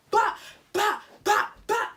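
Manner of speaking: loud, high mock slap sounds; slapping noises. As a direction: bash out the hits as sounds. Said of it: "I went".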